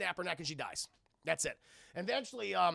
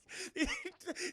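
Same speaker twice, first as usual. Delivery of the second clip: high, breaking-up laughter; laughing; 12 semitones higher, 4 dB quieter than usual. Real, not acted. Streamer amused by clueless Jax, real high laugh. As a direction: crack up mid-story.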